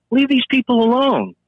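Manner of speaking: high, emphatic appeal; indignant plea. Retold or imitated. Officer objecting to arrests, indignant plea. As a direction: plead with pitch swept up.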